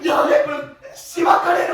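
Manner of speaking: high laughing mock threat; laughing shout. Real, not acted. Member jokingly yells at a friend, high laughing shout.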